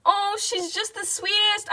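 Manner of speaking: ordinary talk, nothing marked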